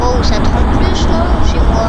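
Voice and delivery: loud, slowed reaction; worried loud "Oh"; loud alarm. Real, not acted. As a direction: call it out as the rocket comes close. Rocket lands near his base.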